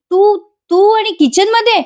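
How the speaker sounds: high, sarcastic disbelief; mock surprise. [name] teasing Priya about being in the kitchen, mock astonished.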